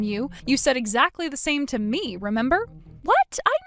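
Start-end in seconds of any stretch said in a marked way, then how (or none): 3.0-3.6 sharp, high startled yelp; shocked what. Acted. Ex-husband caught out by Christine, high shocked yelp. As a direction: jolt up in disbelief. He is accused of same words.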